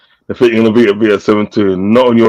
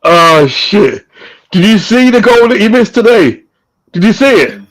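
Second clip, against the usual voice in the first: blurting out in disbelief; exclaiming at a missed open goal; excited outburst; 11 semitones higher than usual. Real, not acted.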